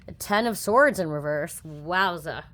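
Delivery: ordinary talk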